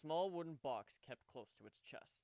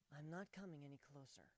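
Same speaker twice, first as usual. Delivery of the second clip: Kuno's voice; 2 semitones higher, 4 dB quieter than usual. Acted. Kuno refuses warily.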